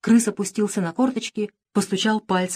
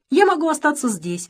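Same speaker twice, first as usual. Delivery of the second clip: sighing; 9 semitones higher than usual. A narrator's reading. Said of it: "вздохнула королева".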